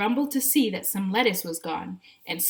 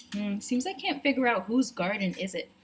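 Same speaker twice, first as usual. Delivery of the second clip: plain reading voice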